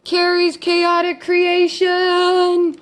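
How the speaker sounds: high, loud, drawn-out winner call; excited announcement. Real, not acted. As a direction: announce it like a prize show.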